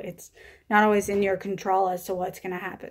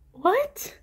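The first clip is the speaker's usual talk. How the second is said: a sudden much higher "What?"; shocked; 10 semitones higher than usual. Retold or imitated. Mother hearing she carries twins, shocked high yelp. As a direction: hit it like stunned disbelief. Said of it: "but we're shocked".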